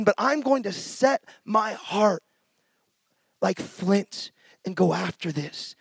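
quiet resolve. Real, not acted. Resolve spoken softly.

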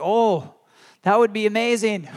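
loud, high burst of wonder; imagined excitement. Retold or imitated. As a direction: gush as if picturing it right now. Imagining the friend's joy aloud.

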